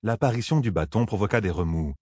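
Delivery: plain reading voice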